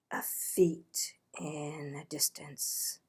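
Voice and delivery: hushed suspense whisper; soft ominous whisper. A narrator's reading. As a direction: whisper it like danger is near. Approaching hunters, suspense.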